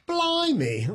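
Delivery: a high Cockney Blimey. Pretend. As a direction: toss out Blimey in a mock Cockney accent. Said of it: "I got it set to Cockney".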